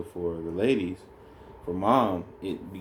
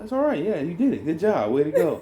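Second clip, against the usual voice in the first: comforting; 7 semitones higher than usual. Retold or imitated. Husband comforting his wife after delivery, mock gentle. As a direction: soothe her lightly in a raised voice. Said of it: "I'm like".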